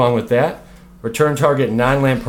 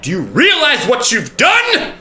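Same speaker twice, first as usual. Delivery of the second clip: slow, higher, loud hammed-up yell; mock outraged shout; 10 semitones higher, 4 dB louder than usual. YouTuber yelling at a pretend commenter, slow mock-outraged shout. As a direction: bellow it like a wounded tyrant.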